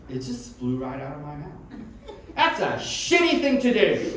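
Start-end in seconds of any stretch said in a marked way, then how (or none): 2.3-4.2 high, loud and drawn-out yell across the street; retold yell. Bob yelling at sign-pullers, loud and high. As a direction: belt it across the road at strangers.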